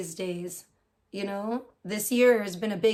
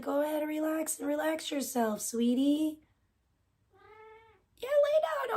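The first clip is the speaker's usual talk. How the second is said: higher, softer pet talk; cooing at cat; 6 semitones higher, 3 dB quieter than usual. Real, not acted. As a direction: coo to the kitty to settle her.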